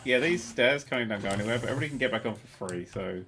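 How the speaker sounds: normal talking voice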